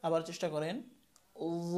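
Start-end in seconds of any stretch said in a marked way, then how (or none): 1.8-1.8 ল drawn out as a full-mouthed voiced th plus "o"; thick heavy letter. Shown as a demonstration. Teacher asking learners to retry, thick heavy zo. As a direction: hold ল thick and rounded. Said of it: "মোটা করে উচ্চারণ করতে হবে".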